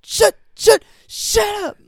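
shrieking very high and loud, shut up over and over; hammed-up screaming shut up; furious screaming. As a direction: scream him down in a rage. Acting out the screaming phone call.

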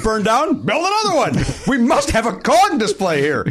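high, hammed-up executive decree; insistent park boss. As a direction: issue it as a mock-grand order. Voicing park bosses committed to Kong.

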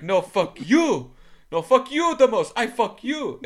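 mimicking two guys shouting insults back and forth; mock Indian English accent. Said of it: "two guys, back at each other".

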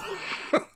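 squeaky unvoiced laugh; high laugh. Real, not acted. Laughing at his own Luffy impression, high giggle.

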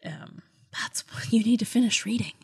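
a playful whispered nudge. Real, not acted. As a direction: whisper it like a secret hint. Whispered nudge to finish book.

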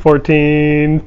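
usual speaking voice

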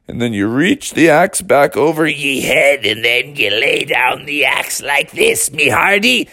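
instruction turning into pirate growl; sliding into pirate. Acted. Dad teaching the axe swing, slipping into pirate voice. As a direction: start as dad, build into full pirate. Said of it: "you turned into a pirate again".

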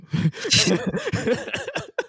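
high-pitched burst of laughter; amused laugh. Real, not acted. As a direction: crack up at a corny line. Cracking up at a dated joke.

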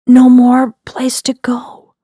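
plain reading voice

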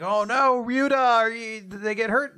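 high, loud worried cry; mock alarm. Friends fearing Ryuta got hurt in the explosion, mock-alarmed cry. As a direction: call out in hammed-up concern.

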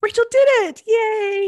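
high, loud celebrating cheer; excited cheer. Real, not acted. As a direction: cheer your sister on in a high, delighted voice. Cheering her sister's success.

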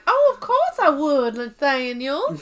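high, loud Southern belle, slowing on the name; hammed-up Southern belle accent. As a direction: gush it in a mock Southern accent. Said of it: "And she's like".

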